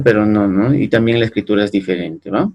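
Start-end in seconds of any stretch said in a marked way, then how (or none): none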